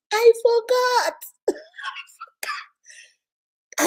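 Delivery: hammed-up teasing of the husband's excuse; mocking whine. Mocks husband's excuse, laughing.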